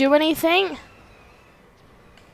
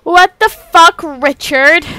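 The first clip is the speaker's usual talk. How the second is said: loud, slow, raised-pitch disbelief; outraged shout; 5 semitones higher, 10 dB louder, slower than usual. Real, not acted. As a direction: holler it at Richard, stretching the words. Exclaims at the game's action.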